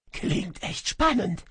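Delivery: high, strangled, stammering through swollen lips. A narrator's reading. Djinn, throttled by the shadow, stammers high and strangled. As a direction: choke the words out, throat squeezed. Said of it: "stammelte ich mit geschwollenen Lippen".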